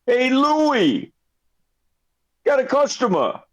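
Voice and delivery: high voice calling to the back room; shopkeeper calling out. Acted. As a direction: call for your assistant. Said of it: "and he says".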